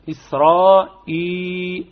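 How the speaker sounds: إسرائيل slow, the madd held two beats; short madd demo. Shown as a demonstration. Teacher models the exception word. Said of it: "نتقى اثنين الحركات".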